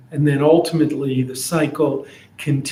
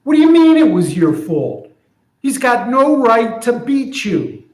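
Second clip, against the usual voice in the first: higher, louder and fast, indignant friend; outraged friend; 8 semitones higher, 4 dB louder than usual. Retold or imitated. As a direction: friend refusing the donkey's excuse. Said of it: "So here's what I'm imagining".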